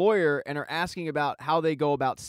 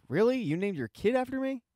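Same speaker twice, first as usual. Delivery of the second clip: pitched higher, a lightly acted disbelieving question; incredulous; 7 semitones higher than usual. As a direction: ask it like you can't believe what you just heard. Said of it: "Josie looks up at Laney like".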